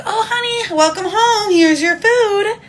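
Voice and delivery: high, slow sugary housewife voice; mock sweet housewife. Mocking the doting wife greeting her fiance with high sweetness. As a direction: ham up the doting traditional wife. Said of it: "I'm never gonna be like".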